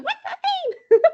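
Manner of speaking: put-on very high, giggling little girl; excited squeal. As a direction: squeak the question through laughter. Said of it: "her going".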